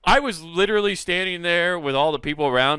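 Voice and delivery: normal talking voice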